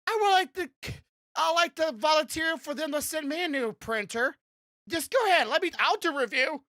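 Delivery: high, eager begging voice; pleading to be sent a printer. Pretend.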